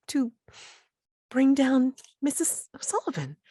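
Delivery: high, tearful, breaking pleas; distressed pleading. Acted. Mildred pleads with the intruders, high and tearful. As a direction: plead with them, close to tears.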